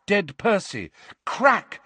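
plain reading voice

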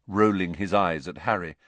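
ordinary delivery

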